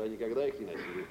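ordinary delivery

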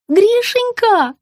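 high, slow cooing; lisping baby talk. A narrator's reading. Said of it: "засюсюкала Рита".

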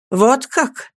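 high, rising scornful question; contemptuous. A narrator's reading. Copperfield scorns the offer of forgiveness, contemptuous. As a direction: toss it back with contempt. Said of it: "с презрением бросил я".

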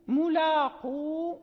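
"ملاقو" slow, ending on a long u; stopping on the waw. Shown as a demonstration. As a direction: hold the final waw of "ملاقو" when you stop. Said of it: "مثلًا". (الوقف على الواو في "ملاقو" بمد)

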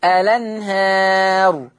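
الأنهار said slowly as "lenhar", the hamza dropped and its fatha on the lam; slow demo of الأنهار with the hamza carried over. Shown as a demonstration. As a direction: draw out الأنهار with the vowel moved onto the lam. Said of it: "أحط الحركة على اللام فتبقى لام مفتوحة".

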